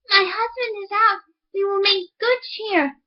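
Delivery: a hint of a sly farm wife; miller's wife voice.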